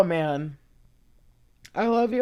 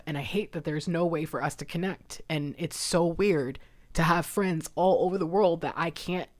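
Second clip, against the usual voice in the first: usual speaking voice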